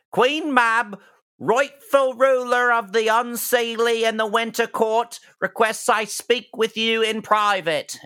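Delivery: high, loud creature proclamation; orc herald. Acted. Orc delivering Queen Mab's summons, high formal herald voice. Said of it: "he says".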